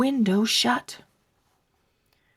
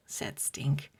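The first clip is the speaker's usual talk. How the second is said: usual speaking voice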